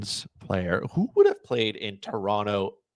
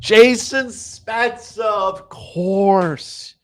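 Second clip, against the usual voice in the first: much higher, slow, savoured; drawn-out exclaim; 10 semitones higher, much slower than usual. Real, not acted. Streamer to chat, high and slow, delighted with the pick. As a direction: stretch the name out with delight. Streamer naming an answer with relish.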